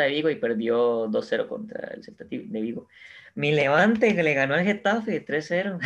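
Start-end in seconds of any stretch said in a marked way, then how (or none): 3.3-5.9 laughing while telling the score; giggling through the result. Real, not acted. Gloating over his team's win.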